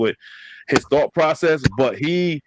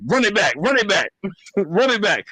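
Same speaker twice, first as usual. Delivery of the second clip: higher-pitched insistent rematch demand; imitated mad demand; 7 semitones higher than usual. Sibling mad after losing at Madden, imitated insistence. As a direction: repeat it like a sore loser. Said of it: "you used to get mad, but you were like".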